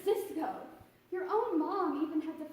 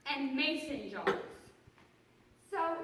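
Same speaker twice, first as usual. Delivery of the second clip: ordinary talk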